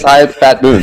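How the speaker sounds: lightly voiced smooth TV host sign-on; a hint of a variety show host's greeting; 1970s TV special host. [name] introduces himself like a TV special host. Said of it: "like it was a nineteen seventy T V special".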